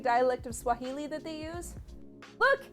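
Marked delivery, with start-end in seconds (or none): none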